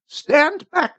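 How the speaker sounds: high-pitched defiant warning; woman crying out. A narrator's reading. Old woman warns off the priest, high cry. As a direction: cry high to ward him off. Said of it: "she cried".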